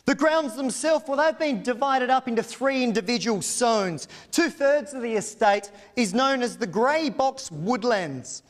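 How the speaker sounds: polished, persuasive property pitch; auctioneer's sales pitch. Acted. Auctioneer selling the estate to buyers, persuasive showman pitch. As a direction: sell the estate to the crowd with flourish.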